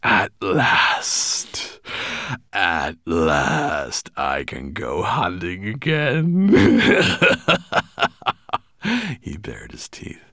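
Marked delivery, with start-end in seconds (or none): 0.0-6.5 slow, breathy relish building louder; drawn-out gleeful anticipation; gloating sigh. A narrator's reading. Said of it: "he sighed". 6.5-8.6 loud, high villainous chuckle; evil dragon's cackle of glee; gloating laugh. A narrator's reading. Dragon gloating over coming hunt.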